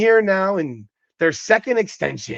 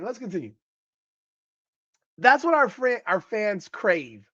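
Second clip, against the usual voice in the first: normal talking voice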